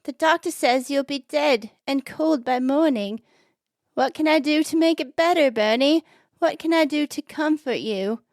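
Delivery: high, hammed-up British voice; amateur British wife at the deathbed; British accent. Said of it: "that was my British voice".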